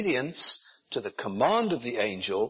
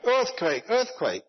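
much higher-pitched imitation of a phone alarm; mock shouted alert; 10 semitones higher than usual. Pastor imitating his phone's earthquake warning, high mock shout. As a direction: call it out high, like a sudden warning. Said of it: "it suddenly started shouting out".

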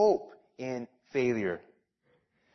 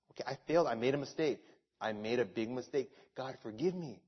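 humble confession; 4 semitones lower, 9 dB quieter, faster than usual. Retold or imitated. Confessing prayer voice.

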